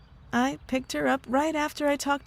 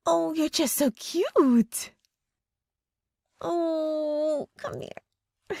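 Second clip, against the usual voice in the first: higher, slow, doting coo; cooing to pet; 6 semitones higher, much slower than usual. Acted. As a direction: gush over the little creature like a baby. Cooing over her pet familiar.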